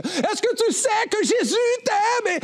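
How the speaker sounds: crié très haut et fort pour couvrir la musique; crié par-dessus la musique. Retold or imitated. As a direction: hurle pour passer au-dessus de la musique d'une fête. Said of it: "je suis obligé de crier par-dessus". (crié très aigu et fort au couple, sur la musique)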